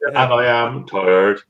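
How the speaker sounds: low, dragging, worn-out delivery; weary. Real, not acted. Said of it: "very tired".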